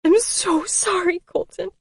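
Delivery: high tearful plea; tearful sorry. A narrator's reading. Still crying as she apologises to Colton. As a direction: let the tears carry it. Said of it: "I cried".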